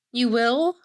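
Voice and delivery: high rising question; mock surprise. Amy asks Stacy about going down, mock surprise. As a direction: imitate a hopeful, surprised friend.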